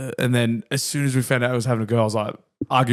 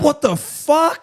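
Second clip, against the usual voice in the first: stunned disbelief; much slower than usual. Real, not acted. Shocked by the weight loss.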